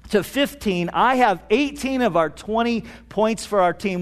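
ordinary talk, nothing marked

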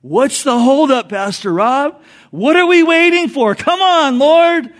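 high, loud impatient whine; hammed-up impatient plea; mock impatience. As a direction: congregation member pestering the pastor, impatiently. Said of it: "some people are, like, going".

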